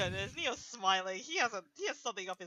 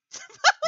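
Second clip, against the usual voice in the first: sudden shrieking laugh; loud high laugh; 15 dB louder than usual. Real, not acted. Burst of laughter at the table.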